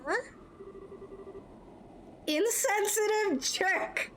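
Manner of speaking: put-on high, slow, pouting girl; Estelle sulking. Girl scolds her absent partner, a high, slow, pouting grumble. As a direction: spit it out like a hurt teenager.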